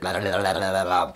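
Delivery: low tongue-flapping babble; gecko lip-licking noise. Acted. Storyteller imitates gecko licking his lip, low tongue flapping. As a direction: flap the tongue rapidly, low.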